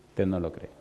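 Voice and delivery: "Usted no lo cree" dicho más bajo y suave; déjalo caer en voz baja; reproche suave. Real, not acted. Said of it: "repite más bajo". (repite a la iglesia, bajando la voz)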